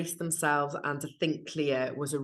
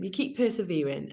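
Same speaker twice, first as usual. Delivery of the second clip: ordinary talk, nothing marked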